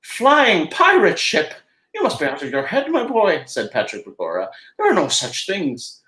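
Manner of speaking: a lightly voiced old leprechaun mocking the idea; scoffing leprechaun. Patrick scoffs at the plans.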